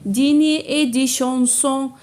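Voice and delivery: slow, measured poem reading with sustained, level notes; poem recitation. Shown as a demonstration. Teacher reads the poem to pupils, slow measured recitation. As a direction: recite line by line, drawing out the words.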